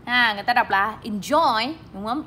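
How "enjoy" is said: enjoy with JOY raised; stress on second syllable. Shown as a demonstration. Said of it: "Lên giọng ở âm số hai".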